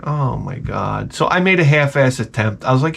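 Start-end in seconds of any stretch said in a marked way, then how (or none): 0.1-1.1 slow, low, softer mock groan; weary dismay. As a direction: drag it out low in mock despair. Dreading the child's repeated request.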